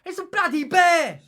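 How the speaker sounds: yelling, voice jumping high; alarmed shout. Real, not acted. Reacting to a bear at the window.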